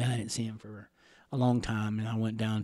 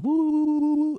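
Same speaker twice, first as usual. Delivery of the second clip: mock war whoop; 7 dB louder than usual. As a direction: parody a cartoon Indian whoop, way up high.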